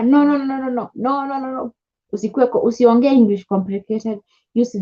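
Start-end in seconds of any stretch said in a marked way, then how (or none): 0.0-1.7 higher, repeated no fading out; firm refusal. Retold or imitated. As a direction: turn it down with a string of no.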